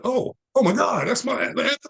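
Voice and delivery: high-pitched mock astonishment; amazed recognition. Father meeting his son on the street, astonished. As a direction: re-enact a delighted, high gasp of recognition.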